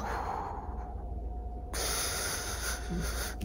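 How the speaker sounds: long, soft, unvoiced sigh; whispered sigh. Real, not acted. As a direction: let the breath go quietly. Trying to calm down, crying.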